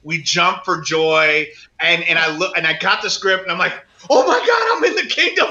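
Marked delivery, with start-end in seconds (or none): 4.1-5.5 high, thrilled gasp of joy; retold excitement. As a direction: re-enact giddy disbelief at great news. Retells joy at getting the script.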